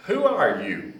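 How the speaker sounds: sudden high, loud challenge from a cussing farmer; startled loud shout. Retold or imitated.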